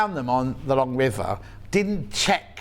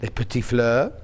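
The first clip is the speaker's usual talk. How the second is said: put-on French with Yorkshire accent; 6 semitones lower than usual. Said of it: "speaks French with a Yorkshire accent".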